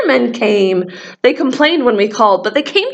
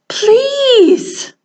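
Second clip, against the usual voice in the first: pleading; 10 semitones higher than usual. Acted. Character pleading after get out.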